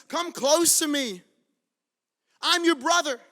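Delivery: high, loud, stretched-out plea; impassioned call. Acted. Joseph calling his brothers at the moment of healing, impassioned call. Joseph's invitation retold at full force.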